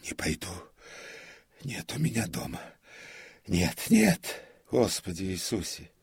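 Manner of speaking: from near-whisper up to high wail; distraught refusal. A narrator's reading. Comic tells prompter he has no home, distraught. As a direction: start hushed, then cry out in anguish.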